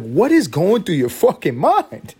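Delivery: loud, slow, high exasperation; exasperated yell. Retold or imitated. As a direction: yell in disbelief at the toddler. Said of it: "I'm like".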